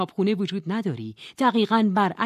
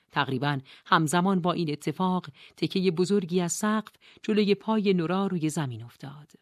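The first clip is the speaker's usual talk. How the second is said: usual speaking voice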